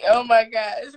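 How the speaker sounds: normal talking voice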